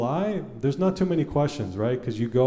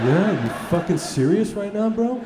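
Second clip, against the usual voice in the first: higher, imitating the friend's outraged yell; mock angry disbelief; 8 semitones higher than usual. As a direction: hit it like a furious buddy who can't believe it.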